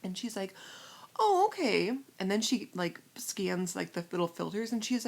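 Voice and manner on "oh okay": high, loud chirpy acknowledgement; mock-perky cashier reply; imitated cashier. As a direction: imitate her with a high-pitched lilt.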